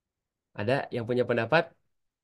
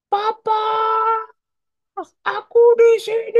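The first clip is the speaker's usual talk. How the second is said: put-on much higher, a small child calling for papa; child's high call, a bit louder; 8 dB louder, slower than usual. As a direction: call out as a little kid looking for his father. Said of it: "anak Herli bilang".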